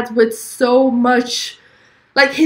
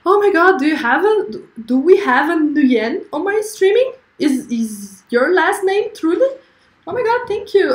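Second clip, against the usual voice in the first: delighted surprise; 7 semitones higher than usual. Real, not acted. Viewer with the surname Nguyen joins.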